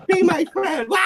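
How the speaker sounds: very high little-bird plea breaking into a cartoon wail; mock shriek from a doomed baby quail. Shown as a demonstration. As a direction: beg sweetly, then squeal. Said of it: "do your quail voice".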